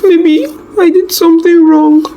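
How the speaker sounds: very high, tearful, breaking voice; wife crying to husband on the phone; sobbing. A narrator's reading. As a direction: sob it out in self-blame. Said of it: "Mary cried harder".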